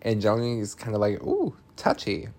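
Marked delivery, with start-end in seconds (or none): 1.2-2.3 much higher, teasing sing-song; mocking tease. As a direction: needle her for being touchy. Joni mocking Ellie.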